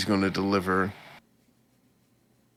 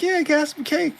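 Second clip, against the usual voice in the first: a squeaky, much higher put-on voice; mock squeaky voice. Retelling the anime, the man offers the girl cake in a silly high voice. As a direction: toss off the line in a silly high voice. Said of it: "He's like".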